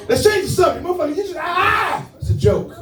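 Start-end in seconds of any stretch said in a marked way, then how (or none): 0.9-2.1 mock shout.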